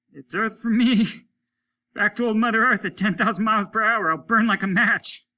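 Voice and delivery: high, loud laugh at his own doom; a falling man laughing as Earth rushes up; bitter laughing. Acted. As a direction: laugh it off though he is about to burn. Doomed man laughing at fate.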